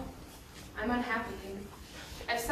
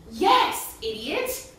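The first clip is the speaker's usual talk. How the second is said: loud, high yes, then a drop; irritated snap; 4 semitones higher, 5 dB louder, much slower than usual. Acted. Confirming it's Persephone, irritated snap. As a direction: snap at a slow listener. Scolding a slow listener.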